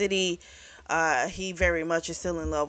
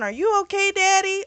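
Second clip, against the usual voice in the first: put-on little girl; 12 semitones higher, 4 dB louder than usual. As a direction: voice jumps much higher and a bit louder, like a small child. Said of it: "she's going".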